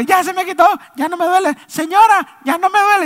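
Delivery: put-on very high, loud, insistent child voice; little boy. Preacher as the boy announcing relief to a lady passenger, little-boy voice. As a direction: call out like a kid telling everyone. Boy telling whole plane.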